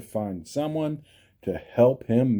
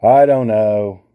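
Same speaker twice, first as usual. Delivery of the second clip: fast frustrated; 4 semitones lower, 7 dB louder, faster than usual. Real, not acted. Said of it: "I keep just saying the wrong words".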